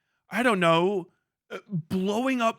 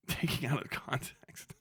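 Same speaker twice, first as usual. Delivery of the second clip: breathy laughing words; giggled under breath; laughing whisper; 3 semitones lower, 10 dB quieter than usual. Real, not acted.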